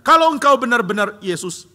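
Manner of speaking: high, loud daring call; loud, high bold challenge; Peter calling out. Retold or imitated. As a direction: shout the challenge over the water. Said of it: "Begitu Petrus mengatakan".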